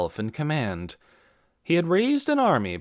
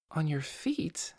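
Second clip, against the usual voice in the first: whispered, slow, softer; shocked whisper; 6 semitones higher, 6 dB quieter, slower than usual. A narrator's reading. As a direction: breathe it in disbelief. Lena surprised at burns.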